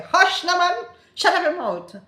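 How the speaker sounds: loud, high, laughing hush to silence someone; silencing someone. Shown as a demonstration. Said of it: "when you're trying to silence someone, you say".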